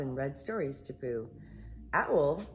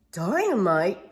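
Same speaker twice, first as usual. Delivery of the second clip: alarmed cry; 2 semitones higher, 8 dB louder than usual. Acted. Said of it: "Pooh cried".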